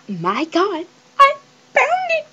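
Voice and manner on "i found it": high, teary shriek of joy; overjoyed cry. Real, not acted. Girl finding the item she wanted, teary squeal. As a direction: squeal it out as if near tears.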